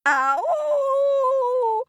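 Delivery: high drawn-out howl; howling moan. Acted. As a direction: wail it like a ghost. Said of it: "half howling and half moaning".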